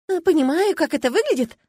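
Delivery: high, flustered patter; gabbled excuse. A narrator's reading. Youth scurries after the dragon, gabbling apologetically. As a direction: rattle off an excuse. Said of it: "затараторил".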